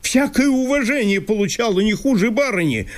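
high, agitated landowner in disbelief; Chertopkhanov voice. Acted. Landowner reproaching Masha for leaving, high and fretful.

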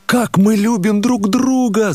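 high and slow, a pained exclamation; anguished cry. A narrator's reading. The narrator cries Nastenka's words back at her, pained. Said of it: "закричал я".

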